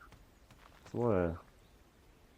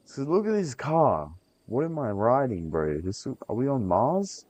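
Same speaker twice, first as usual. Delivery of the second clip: high, louder amazed; 6 semitones higher, 4 dB louder than usual. Real, not acted. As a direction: marvel at it, pitched up. Amazed at the car.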